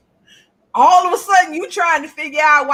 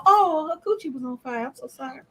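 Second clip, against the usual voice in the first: mock pity; 5 dB quieter than usual. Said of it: "we're not sitting up there going".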